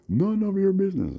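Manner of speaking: high, loud put-on snap; rude neighbour's retort. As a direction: fire it back high and loud like a rude stranger. Man on bus snaps back.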